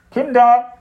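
loud, high call through a door; calling father. A narrator's reading. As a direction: call the children like a dad outside. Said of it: "ertönte Papas Stimme hinter der Tür".

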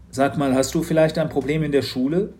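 a hint of a concerned dad; lightly voiced worried father. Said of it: "fragte Papa". (besorgter Vater, leicht gesprochen)

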